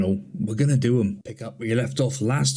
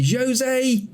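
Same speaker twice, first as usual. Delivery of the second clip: excited shout; 12 semitones higher than usual. Real, not acted. Goal chance, shouting player's name.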